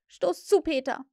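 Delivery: high, loud command; determined, urgent call; resolute. A narrator's reading. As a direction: order it with resolve. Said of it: "sagte er entschlossen".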